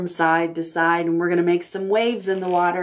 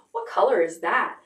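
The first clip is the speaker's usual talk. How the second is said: usual speaking voice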